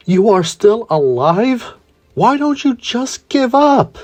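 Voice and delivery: high, slow, scornful villain voice; annoyed. A narrator's reading. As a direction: sneer it out, drawn out and high. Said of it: "annoyed, he would say".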